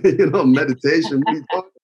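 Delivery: quick, dismissive Brooklyn guy brushing it off; mock Brooklyn scoff, rushed; imitating his old Brooklyn self waving off meditation; scoffing Brooklyn tough guy. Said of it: "Billy from Brooklyn".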